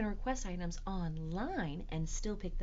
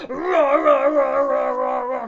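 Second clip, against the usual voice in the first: loud, high rapid-fire roars; dinosaur roar; 7 semitones higher, 18 dB louder than usual. Acted. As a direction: roar hard while scrubbing away. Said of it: "we are going to roar".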